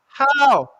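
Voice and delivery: high loud cry of disbelief; exasperated shout. Real, not acted. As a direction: ongelovig geschreeuw. Missed chance.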